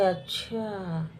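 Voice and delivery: very slow, drawn-out अच्छा falling away; ominous realization. Acted. Stepmother hearing her daughter's report, slow knowing अच्छा. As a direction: draw out अच्छा as the scheme forms.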